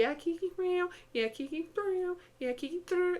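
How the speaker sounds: high, loud chatty cat meows; cat chatter imitation. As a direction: mimic a talkative cat, up high. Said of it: "You can actually go".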